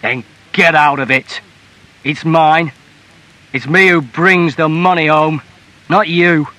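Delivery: loud, forceful husband laying down the law; angry order. A narrator's reading. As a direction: order her out in a rage.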